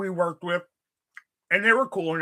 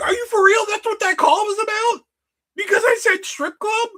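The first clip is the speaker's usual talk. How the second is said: much higher, louder, disbelieving; can't believe the call was over that; incredulous outburst; 5 dB louder than usual. Retold or imitated. Telling the manager about being written up, high and disbelieving. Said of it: "I was like".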